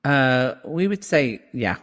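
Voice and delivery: ordinary talk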